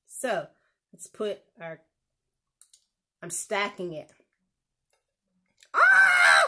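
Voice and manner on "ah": high, loud squeal of delight; excited squeal. Real, not acted. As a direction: let out a sudden squeal of joy. Excited while stacking burger.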